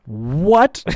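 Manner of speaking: loud, high incredulous what; surprised. Real, not acted. Reacting to old clip.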